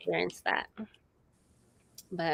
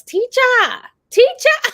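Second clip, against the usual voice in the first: put-on loud, very high child's call; imitated child calling; 10 dB louder than usual. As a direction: mimic a small child calling out, pitched way up. Daughter's first word, acted out.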